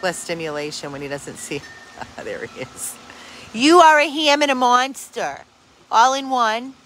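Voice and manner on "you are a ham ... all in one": higher, louder teasing dog talk; playful dog talk. Real, not acted. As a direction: tease the dog fondly, high and loud.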